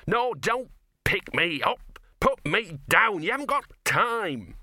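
high, drawn-out desperate protest; high wailing plea to be released; frantic protest. A narrator's reading. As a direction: protest loudly word by word, pitched right up. Gaspode protesting as he is carried.

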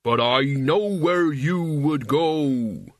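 slow, drawn-out menacing voice. A narrator's reading. Mort threatens Albert with his fate, menacing. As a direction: draw the threat out word by word.

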